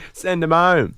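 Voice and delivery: high, loud jeer; mock outrage.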